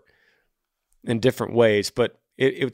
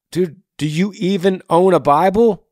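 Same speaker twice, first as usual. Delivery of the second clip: high, loud challenge; pointed, pitched-up incredulous question; 8 semitones higher, 5 dB louder than usual. Acted. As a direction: blurt it out in exasperated disbelief. Confronts caller after guitar analogy.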